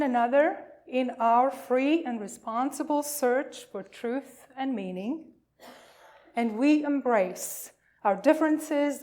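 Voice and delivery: measured, solemn recitation of the statement; formal reading. Acted. Minister reads the new values statement to the congregation, solemn and formal. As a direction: read it out like a creed to the congregation.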